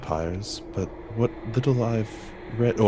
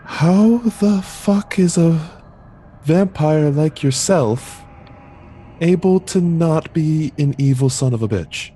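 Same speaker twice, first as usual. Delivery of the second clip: higher, slow, disbelieving; incredulous blunt question; 6 semitones higher, 5 dB louder, slower than usual. Acted. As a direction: spell out the outrage. Player's blunt question to vampire.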